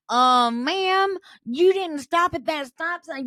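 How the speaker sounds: high, loud mock reprimand; hammed-up official correction; imitated driving instructor.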